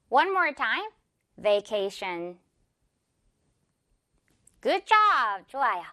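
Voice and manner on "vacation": slow, lower "Vacation"; slow buzzing v opening "Vacation"; clear V. Shown as a demonstration. Teacher giving one more take, slow clear V. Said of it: "V 발음".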